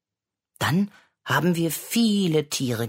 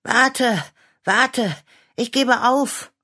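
panting; 10 semitones higher, 5 dB louder, slower than usual. Acted. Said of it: "rief Clara schnaufend".

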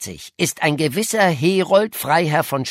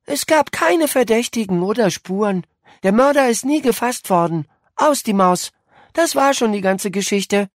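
lightly voiced bored girl, a little higher; 4 semitones higher than usual. A narrator's reading. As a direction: recite it as if it were tedious old news. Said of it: "sagte gelangweilt". (gelangweilt heruntergeleiert)

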